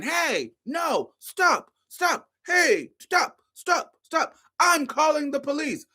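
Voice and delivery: high, frantic hammed-up stop stop stop; imitating panicked man. Man chasing her down the street, pitched high and frantic. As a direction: play him yelling after her, high and desperate. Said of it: "He's saying".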